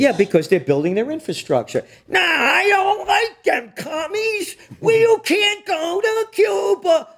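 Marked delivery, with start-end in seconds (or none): 2.1-7.1 high, slow shouted mock yokel voice. Host mocks an anti-Cuba voter with a high, slow yell. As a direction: ham up a yelling anti-communist. Mocking a Trump supporter.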